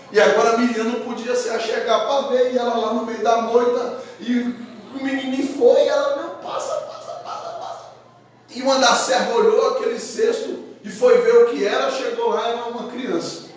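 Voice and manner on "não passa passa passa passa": hushed, pushing whisper to the drifting basket; a sister's secret urging; urgent whisper. Acted. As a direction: whisper it along, willing it to keep going. Miriam urging the basket downstream.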